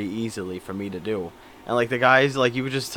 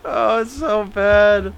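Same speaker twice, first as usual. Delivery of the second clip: laughing; 9 semitones higher, 7 dB louder, much slower than usual. Real, not acted. Laughing at Biden teleprompter gaffe.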